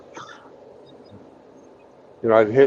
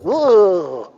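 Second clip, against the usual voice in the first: high, lisping little-man voice with a lisp on "mister"; lisping midget voice; 11 semitones higher, 5 dB louder, slower than usual. Retold or imitated. Telling the midget joke to Hammy, high lisping midget voice. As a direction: pitch up and lisp like the hair-lip midget. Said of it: "He goes".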